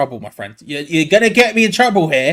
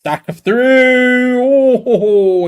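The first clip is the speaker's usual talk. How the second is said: higher, louder, slow drawn-out call; real tense commentator yell; excited shout; 7 semitones higher, 6 dB louder, much slower than usual. Real, not acted. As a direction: call the chance as it happens. Live chance on goal.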